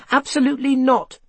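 high, indignant outburst; blustering. A narrator's reading. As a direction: bluster back, pitch leaping up. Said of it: "Seth blustered".

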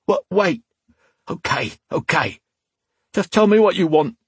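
high, urgent backtracking; cornered thug giving in; frightened pleading. A narrator's reading. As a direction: beg quickly for your life. Thug begs as his life is threatened.

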